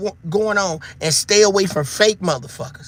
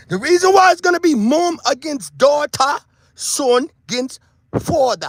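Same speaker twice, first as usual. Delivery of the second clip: mock Jamaican patois lilt, stretched out; a light put-on Jamaican patois accent; 5 semitones higher than usual. Shown as a demonstration. Vlogger to viewers, mock Jamaican patois. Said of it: "I was trying to sound like I was speaking my patois".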